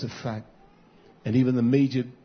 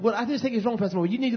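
mimicking the woman; 10 semitones higher, faster than usual. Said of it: "She went".

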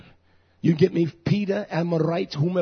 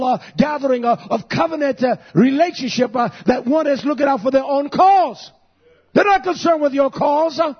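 raised, punchy pulpit cadence; fired-up preaching; 10 semitones higher, 6 dB louder than usual. Real, not acted. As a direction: preach it in driving bursts. Preaches at full force.

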